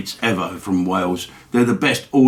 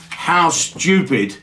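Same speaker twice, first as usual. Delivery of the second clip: ordinary talk, nothing marked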